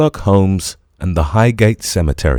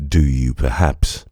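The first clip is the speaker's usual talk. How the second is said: lower, dry German-free detective voice; a lower, probing Holmes; Holmes's voice; 7 semitones lower than usual. A narrator's reading. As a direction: Holmes asks Engels if Marx was murdered, lower and probing.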